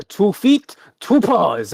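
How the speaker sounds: high, loud circus cue; showman's command. Acted. Ringmaster tells the bear to stand up, loud showy command. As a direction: call the trick out big and high.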